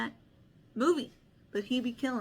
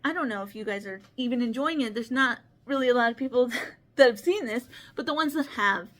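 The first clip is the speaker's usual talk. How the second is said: ordinary delivery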